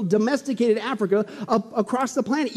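ordinary talk

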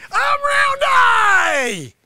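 very slow, high, loud bellow of his own name; boastful shout. Acted. Sensei roars his own name at doubting students. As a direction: roar it out like a proud master.

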